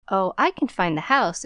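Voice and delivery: ordinary delivery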